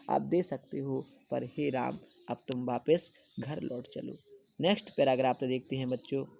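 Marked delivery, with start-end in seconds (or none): none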